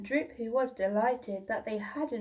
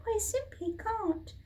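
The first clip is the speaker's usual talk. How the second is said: much higher small apologetic voice; timid little dinosaur; 12 semitones higher than usual. A narrator's reading. Little dinosaur apologising to his parents, high and small. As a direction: squeak it out like a shy hatchling.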